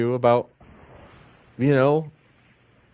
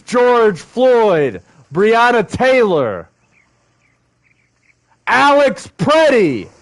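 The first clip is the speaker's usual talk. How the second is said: impassioned; 6 semitones higher, 3 dB louder, slower than usual. Real, not acted. Passionate reading of protest lyrics.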